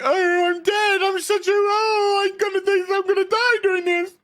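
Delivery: high, whimpering scared voice; hammed-up terrified whine; mock panicking man. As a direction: squeal like a cowardly spy. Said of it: "And he's like".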